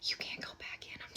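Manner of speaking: soft whispered warning; whispering. Acted. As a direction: whisper it like a secret.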